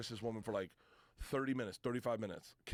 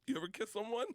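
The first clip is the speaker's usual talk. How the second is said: ordinary delivery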